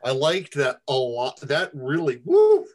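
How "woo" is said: loud high cheer; excited whoop. Real, not acted. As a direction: whoop with delight. Listener cheers the reading.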